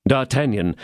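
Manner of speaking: ordinary delivery, nothing marked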